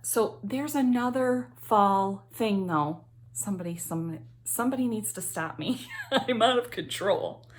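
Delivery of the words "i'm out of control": laughing at herself. Real, not acted. Vlogger admitting she buys too much, laughing. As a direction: giggle through the confession.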